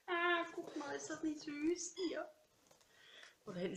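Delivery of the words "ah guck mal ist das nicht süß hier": delighted squeal. Real, not acted. Delighted over the parcel.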